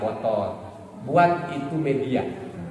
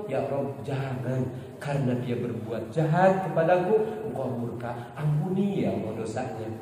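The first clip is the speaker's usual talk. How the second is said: soft, low pleading with tears only suggested; tearful prayer; 6 semitones lower, 7 dB quieter than usual. Acted. Begging God to forgive a wrongdoer, softly tearful. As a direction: beg quietly for mercy, as if in private prayer. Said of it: "Bari nangis".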